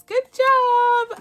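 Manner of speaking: squeaky high "good job"; delighted little cheer; high excited praise. Real, not acted. Praising the budget challenge.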